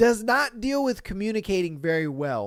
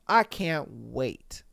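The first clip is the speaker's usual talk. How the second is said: usual speaking voice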